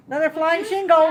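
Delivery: laughing, high and loud; amused laughing exclamation. Real, not acted. Second shingle flies, laughing.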